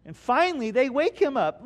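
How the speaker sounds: normal talking voice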